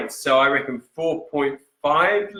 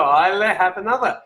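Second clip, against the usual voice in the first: put-on Scottish accent; 5 semitones higher than usual. Host savouring a viewer's suggested name, in a hammed Scottish accent. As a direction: repeat it with a Scottish lilt. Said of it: "play on the whole sort of Scottish accent".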